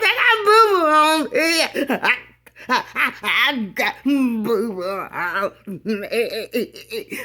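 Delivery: high, slow laughing wail; crying child. Retold or imitated. Little girl covered in mess, wailing to daddy. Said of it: "child crying while laughing".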